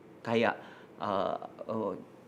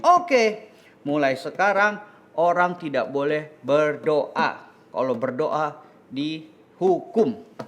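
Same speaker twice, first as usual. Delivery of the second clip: measured royal edict with drawn-out words; king issuing a decree; slower than usual. Acted. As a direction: pronounce the law slowly and deliberately. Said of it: "raja akhirnya bikin peraturan".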